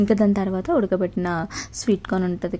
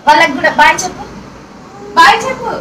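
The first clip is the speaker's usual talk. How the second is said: mother's high-pitched urging; 11 semitones higher, 12 dB louder, faster than usual. Real, not acted. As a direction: coax a little one in a raised, high voice. Mother prompting child to say bye.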